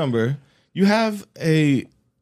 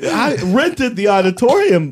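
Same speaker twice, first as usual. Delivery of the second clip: laughing; 7 semitones higher, 4 dB louder than usual. Pretend. Joking as the self-jerker.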